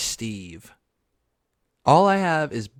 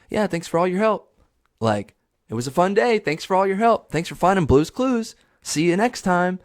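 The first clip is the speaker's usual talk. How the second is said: put-on chirpy children's show sign-off, higher pitched; Steve; 7 semitones higher, 3 dB louder than usual. TV host thanking young viewers at the end, upbeat. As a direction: wrap up the episode brightly for the kids. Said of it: "kinda like a wrap up".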